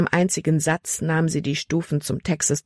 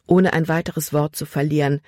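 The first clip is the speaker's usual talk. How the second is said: usual speaking voice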